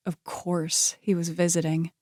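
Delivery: plain reading voice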